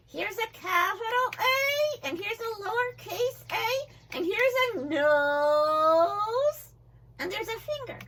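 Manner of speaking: high, slow puppet voice; a puppet proudly pointing things out. Acted.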